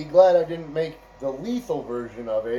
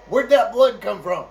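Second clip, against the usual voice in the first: high, loud alarmed question; guard startled by red on his hands; 5 semitones higher, 6 dB louder than usual. Retold or imitated. A guard spotting red dripping hands, alarmed and loud.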